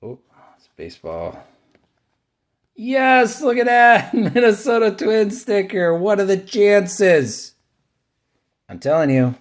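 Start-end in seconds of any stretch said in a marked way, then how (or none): 2.8-7.5 much higher and louder, laughing with delight; excited. Real, not acted. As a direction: cheer the lucky find. Finds Twins sticker, delighted.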